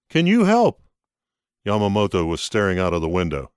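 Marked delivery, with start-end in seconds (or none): 0.1-0.8 higher and louder, asking for help; higher, louder plea for help; pleading character voice. Acted. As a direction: beg them to help, pitched up.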